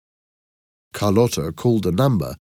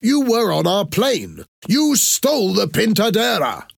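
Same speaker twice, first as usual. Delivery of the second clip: much higher, heated accusing voice; angry accusation; 13 semitones higher, 3 dB louder than usual. A narrator's reading. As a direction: hurl the accusation in anger. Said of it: "He looked very angry".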